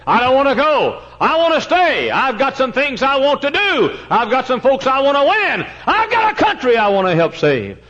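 high, loud pulpit cry; impassioned preaching. Real, not acted. Preacher tells congregation why he wants to stay, impassioned shout.

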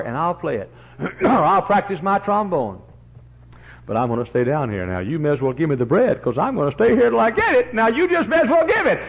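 defiant neighbour, rising; stubborn beggar. Acted. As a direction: dig in and refuse to leave. Beggar threatens to stay all night.

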